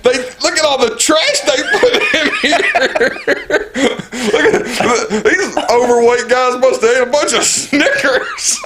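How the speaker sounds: high, laughing mock outrage; joking fake complaint through laughter; playing along. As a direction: pretend indignation while cracking up. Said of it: "So I just played around".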